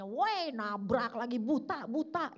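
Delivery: put-on an old husband snapping at his wife for bumping him; hammed-up angry old man; grumpy old-man yell. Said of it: "pas jalan nabrak istrinya".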